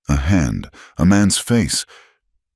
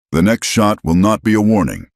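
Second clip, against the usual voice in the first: booming warning; 5 semitones higher, 4 dB louder than usual. Acted. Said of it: "Chaska's voice boomed".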